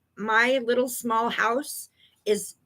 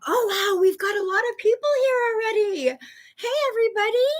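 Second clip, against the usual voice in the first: high, quick delighted hello; light up as the crowd arrives; excited greeting; 11 semitones higher, faster than usual. Real, not acted. Greeting viewers as they join.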